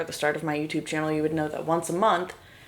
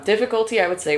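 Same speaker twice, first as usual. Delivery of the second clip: normal talking voice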